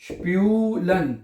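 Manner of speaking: स्प्यूलन slowly in two separate parts; slow sounding-out. Shown as a demonstration. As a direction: stretch spew in स्प्यूलन, then add लन. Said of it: "क्या है".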